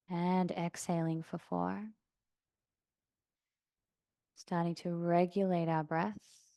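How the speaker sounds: calm yoga-teacher meditation voice, long pauses; soft, settled breathing-practice guidance; guided meditation. Real, not acted. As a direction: guide them gently into stillness, unhurried. Leads seated breathing meditation.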